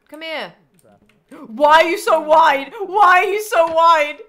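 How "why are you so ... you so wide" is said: mock outraged yell. Teasing the inflated cat character.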